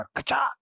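high, explosive slicing sound word; sword-strike effect. A narrator's reading. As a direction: voice the blade hitting home. Imitates sword striking the belly.

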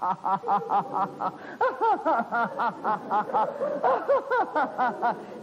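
put-on long giggling old woman's laugh; drawn-out embarrassed old woman's giggle; old wife laughing. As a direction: laugh on and on, tickled. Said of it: "いつまで笑ってんだよ".

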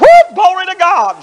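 high, loud exultant whoop; a sudden shout of glory; joyful whoop. Retold or imitated. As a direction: burst out in glad praise. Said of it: "she said".

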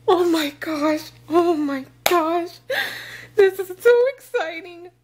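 high excited gushing. Real, not acted. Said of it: "I'm getting so excited like a little kid".

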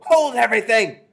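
very high, trumpeting yell; shrieking. A narrator's reading. Witch halting the departing crowd, shrieking. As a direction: shriek it so it echoes through a ballroom. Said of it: "Her shrieking voice echoed".

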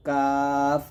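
long light "কাফ"; thin, normal. Shown as a demonstration. Teacher demonstrating the light letter, long thin "কাফ". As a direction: keep "কাফ" thin and drawn out. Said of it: "কাফ পাতলা হবে".

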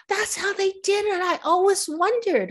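high, wonder-struck mimicry of an excitable child; awed little kid. Actress mimics Tarantino learning about wire work, childlike amazement. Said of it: "like, a little kid that actually believed".

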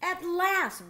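high, eager cry of joy; a bear's dream come true, cry it out; excited cry. A narrator's reading. Young bear thrilled at last, bright excited cry. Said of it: "Bernard cried".

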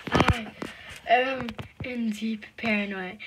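usual speaking voice

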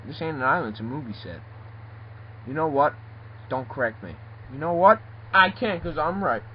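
ordinary talk, nothing marked

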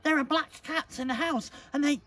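high-pitched urgent shout; shouted alarm. Acted. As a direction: shout the warning, high and loud. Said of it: "shouted Vajak".

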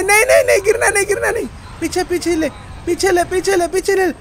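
high-pitched repeated pleas; urgent, high gamer panic; panicked urging. Real, not acted. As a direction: urge a retreat in a panic. About to fall, reversing in panic.

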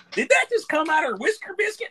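very high squealing Carolina Southern woman voice; Carolina Southern accent. Retold or imitated. Retelling the duet again, repeats the Carolina Southern woman's line. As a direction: pitch way up into a mock Carolina Southern belle. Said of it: "she had the Carolina Southern accent".

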